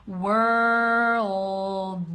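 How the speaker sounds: drawn out sound by sound; slow-motion teacher's demo; slow pronunciation. Shown as a demonstration. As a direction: stretch the word, releasing the final D separately. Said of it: "pronounce it slowly for you".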